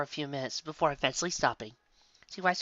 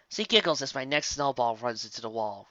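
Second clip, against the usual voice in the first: ordinary delivery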